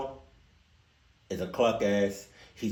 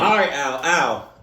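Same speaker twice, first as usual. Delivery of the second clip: louder, fired-up calling out Al; worked up; 3 semitones higher, 7 dB louder than usual. Real, not acted. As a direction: call him out, getting heated. Worked up threatening Al.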